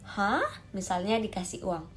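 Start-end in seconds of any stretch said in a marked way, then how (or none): 0.0-0.4 startled "Hah" shooting upward; short questioning gasp of surprise; shocked rising huh. Shown as a demonstration. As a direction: react in mock shock. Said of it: "seperti kita shock".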